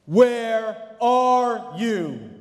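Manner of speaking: put-on a father calling out for lost children; slow loud searching call. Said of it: "He yells out".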